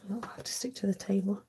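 soft whisper to herself about the table; whispered aside. Real, not acted. Crafter muttering to herself on a live stream, whispered. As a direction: breathe it out under your breath.

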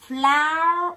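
teacher drilling Flower aloud; syllable demo. Shown as a demonstration. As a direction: punch out Flower beat by beat. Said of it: "E R nó có âm ơ".

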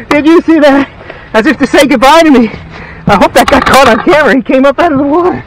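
blurting it out, thrilled and breathless; excited rush. Real, not acted. Angler to viewers after the fish leaps, fast and excited.

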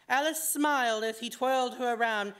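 plain reading voice

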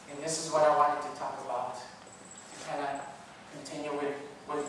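usual speaking voice

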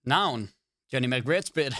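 normal talking voice

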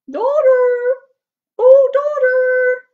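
high, loud drawn-out holler; calling out. Acted. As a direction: sing out for someone far off. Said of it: "the wife called the daughter".

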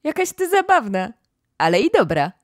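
lightly voiced excited girl; Ermengarda. A narrator's reading. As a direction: gush at her friend. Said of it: "Ermengarda zaczęła się śmiać".

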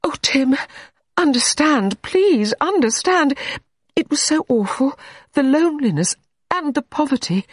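high, pleading, near tears; pleading cry. Acted. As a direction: beg him to understand. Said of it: "Deirdre cried out".

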